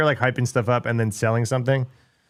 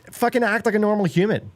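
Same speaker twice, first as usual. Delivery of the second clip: exasperated; 10 semitones higher than usual. Real, not acted. Exasperated rant at online people.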